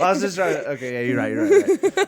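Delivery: high, loud laughing concession; flustered laughing. Real, not acted. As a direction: laugh it off, caught out. Caught out, laughing.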